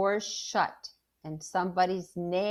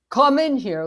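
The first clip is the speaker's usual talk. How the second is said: loud, high call from the house; 6 semitones higher, 10 dB louder than usual. A narrator's reading. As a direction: call a child indoors. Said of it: "Daddy's calling from the house".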